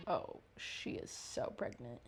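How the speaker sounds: whisper. Real, not acted. Watching film, hushed reaction.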